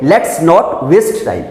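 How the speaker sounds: each word slow and careful; slow model reading. Shown as a demonstration. Teacher reads the example slowly. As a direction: read it out slowly. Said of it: "तो क्या बोलेंगे".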